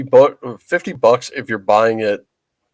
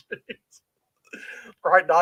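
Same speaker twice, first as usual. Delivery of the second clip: ordinary talk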